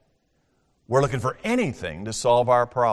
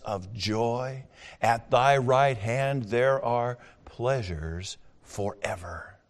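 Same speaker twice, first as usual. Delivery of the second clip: slow, solemn psalm reading, louder at first; scripture reading; 5 dB louder than usual. Acted. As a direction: read the psalm slowly, like a solemn text from the pulpit. Reads a psalm aloud.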